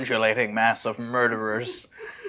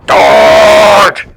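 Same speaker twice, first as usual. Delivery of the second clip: loud, low barked shout; sudden battle shout; 8 semitones lower, 17 dB louder than usual. Acted. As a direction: yell the warning. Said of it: "He shouts".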